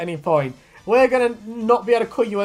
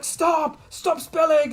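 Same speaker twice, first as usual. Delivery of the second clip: high-pitched retold yell at a spilled drink; half-playful imitation of someone shouting at himself; mock angry shouting; 10 semitones higher, much slower than usual. King Cobra yelling at his drink, done as a mock shout. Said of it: "getting mad at himself and shouting".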